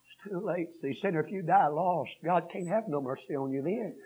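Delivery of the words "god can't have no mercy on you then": ordinary delivery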